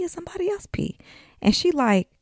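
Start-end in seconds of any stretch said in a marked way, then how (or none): 0.0-1.0 whispered in disbelief; a whispered retold question. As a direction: hush it, half-confused.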